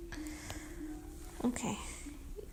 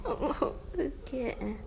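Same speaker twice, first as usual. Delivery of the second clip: ordinary talk, nothing marked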